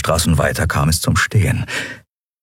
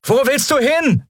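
much higher, calling out over the noise; calling after her. Acted. Lien calls after the fleeing Endriel, raised call. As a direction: shout the question after someone running off. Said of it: "als sie ihr nachrief".

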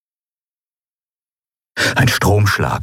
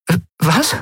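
confused; 9 semitones higher than usual. A narrator's reading. Lien confused.

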